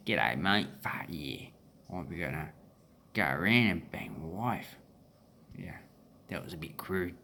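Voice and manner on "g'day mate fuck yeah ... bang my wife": low, rough-and-ready broad Australian; broad Australian accent. Shown as a demonstration. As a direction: imitate a working-class broad Australian, pitched down. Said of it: "And the broad one is".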